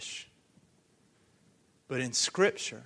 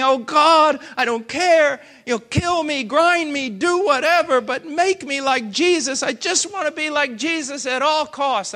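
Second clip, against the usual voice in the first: loud, high desperate pleading prayer; retold crying prayer; 9 semitones higher, 10 dB louder than usual. Preacher reliving his tearful all-night prayer, loud pleading. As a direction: cry out to God, half-shouting, begging. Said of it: "And I'm crying".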